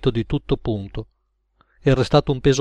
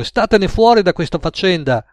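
higher, emphatic warning; raised, insistent plea; agitated warning; 6 semitones higher, 4 dB louder than usual. A narrator's reading. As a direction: urge them to stay clear. Cook warns them off.

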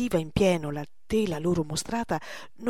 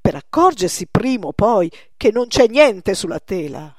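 louder, insistent outburst; young painter loses patience, loud exasperated exclamation; 9 dB louder than usual. Acted. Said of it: "esclamò Poussin".

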